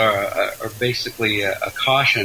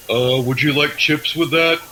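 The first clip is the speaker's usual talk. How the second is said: lightly voiced fast-food server, slightly higher; a hint of a grad taking food orders; political science grad impersonation; 5 semitones higher than usual. Guest jokes to host about jobless graduates, lightly acted impersonation. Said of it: "This is my impersonation of a recent".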